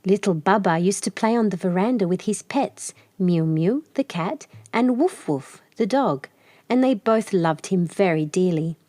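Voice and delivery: plain reading voice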